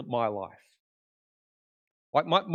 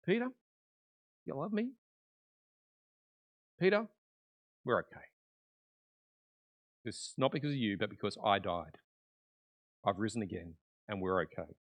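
soft and hushed, mostly much quieter; tender Jesus voice; 7 semitones lower, 9 dB quieter than usual. Acted. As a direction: speak to Peter gently, like a reassuring friend. Jesus speaks gently to Peter.

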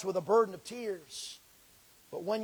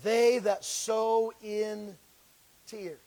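slow emphasis; much slower than usual. Real, not acted. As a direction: stretch it, let tears fall away. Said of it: "repeats the verse for emphasis".

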